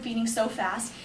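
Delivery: ordinary talk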